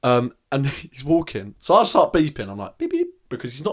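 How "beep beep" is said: high squeaky beep beep; mock horn beeps. Driver mimicking his horn at a pedestrian, high beeps. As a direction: imitate a car horn with your voice.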